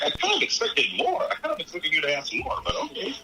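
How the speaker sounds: high-pitched mock reaction of a movie dad; imitated letdown reaction. Film fan voices Hanks's look at his son, high mock letdown. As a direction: voice the dad's inner reaction, pitched well up. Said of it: "Hank has this look on his face that's like".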